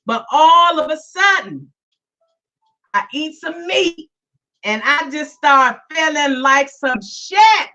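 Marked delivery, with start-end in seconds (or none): none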